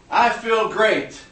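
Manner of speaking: loud, slow shout; outside voice. Shown as a demonstration. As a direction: shout it out to the room. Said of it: "use their best outside voice".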